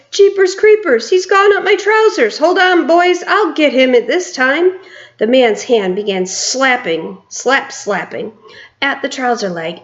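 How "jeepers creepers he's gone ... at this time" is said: high, loud panicky cook's cry; panicked shout. A narrator's reading. Cook calling the boys for help against the mouse, high panicked yell. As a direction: yell to the other cooks in alarm. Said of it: "the cook shouted".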